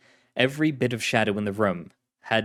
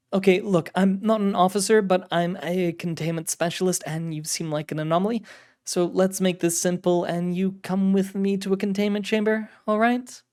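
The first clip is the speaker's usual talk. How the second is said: higher-pitched officer, trying to keep it simple; firm containment officer; 9 semitones higher than usual. Acted. Officer asks anomaly to come to containment, high, insistent voice. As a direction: reason firmly with an odd stranger.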